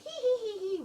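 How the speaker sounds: high, mocking witchy tittering; shrill-pitched cartoon witch "hee hee"; witch cackle. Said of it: "with the witch hat on".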